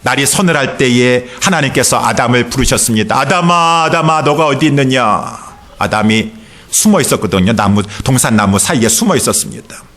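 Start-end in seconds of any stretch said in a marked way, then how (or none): 3.2-5.4 drawn-out, higher-pitched call to Adam; God calling out. Acted. Preacher voices God calling to hiding Adam, calling out. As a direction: call out as God seeking someone hidden.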